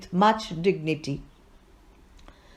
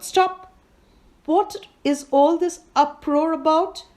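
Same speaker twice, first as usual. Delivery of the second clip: sharp raised call, pitched well up; 6 semitones higher than usual. A narrator's reading. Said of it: "the justice called out".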